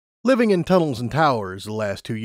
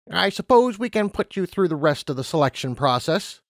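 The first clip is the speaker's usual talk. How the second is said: lightly voiced interviewer, higher pitch; Harmony Treasure, relenting; 6 semitones higher than usual. A narrator's reading. Interviewer conceding a chance to Lumina, lightly voiced character. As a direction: give in, somewhat raised voice.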